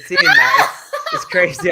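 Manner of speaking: laughing through awkward words. Real, not acted. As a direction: giggle it out, embarrassed. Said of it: "I can't do it".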